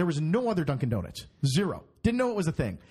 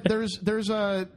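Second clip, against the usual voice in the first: ordinary delivery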